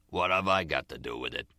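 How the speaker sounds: low-voiced, unbothered boss; indifferent mob boss. A narrator's reading. Jimmy Cap answers Nicky's complaint, indifferent boss voice.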